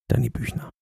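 breathy whispered promise; a secret whispered promise to the listener. Acted. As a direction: lean in and whisper it. Whispered aside naming a contestant.

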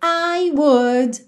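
very slow, drawn out; slow model structure. Shown as a demonstration. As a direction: spell out the structure slowly. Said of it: "Então, olha". (estrutura dita bem devagar)

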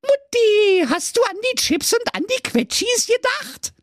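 put-on high demanding child; whiny kid. A narrator's reading.

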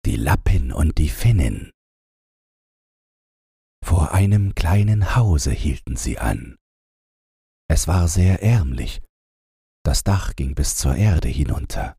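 plain reading voice

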